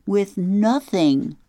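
"With nothing?" asked high and slow, stretching "nothing"; a girl asks aloud, horrified at coming back with nothing; dismayed question. Acted. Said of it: "She paused for a minute and repeated".

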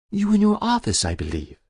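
put-on a guilty old woman pleading with the inspector; Muriel's voice. Muriel shows the clipping.

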